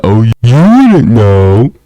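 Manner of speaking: a slow, lightly put-on voice for the smug brother; mock brother. Retelling the brother's smug remark in a slow, lightly mocking voice. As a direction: drag it out like a know-it-all brother. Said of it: "He's like".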